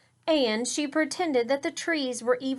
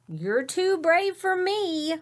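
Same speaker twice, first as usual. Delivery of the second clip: timid sister; 8 semitones higher, 5 dB louder than usual. Acted.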